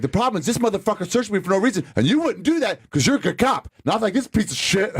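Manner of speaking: high-pitched, heated appeal; retold indignant complaint. Retelling his complaint to two cops, indignant. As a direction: replay the grievance, pitched well up.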